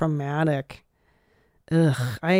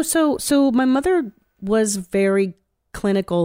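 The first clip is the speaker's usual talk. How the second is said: ordinary delivery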